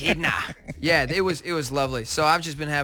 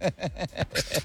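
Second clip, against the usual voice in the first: laughing; 6 dB quieter than usual. Real, not acted. Guest laughs at joke.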